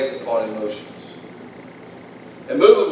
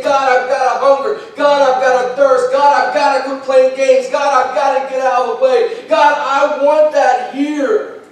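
higher, pleading prayer, slowing at the end; praying to God; 11 semitones higher, 4 dB louder than usual. Retold or imitated. Preacher voices the believer's hungry prayer to God. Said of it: "pursuing Him and saying".

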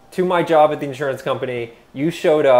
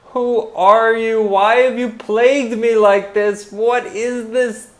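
scared shouting; 10 semitones higher, 7 dB louder, slower than usual. Acted. Terrified at the haunting voice.

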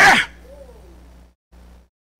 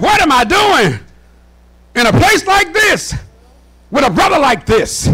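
raised, preacher's mock dismay; exasperated self-questioning; 5 semitones higher than usual.

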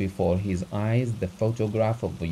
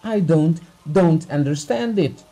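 agitated; 6 semitones higher, 7 dB louder than usual. Acted. Abugin's outburst over the note.

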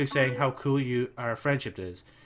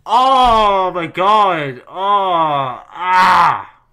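loud, stretched-out shocked cries; shocked yelling; 6 semitones higher, 14 dB louder, much slower than usual. Real, not acted. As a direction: yell in shock. Shocked reaction to game twist.

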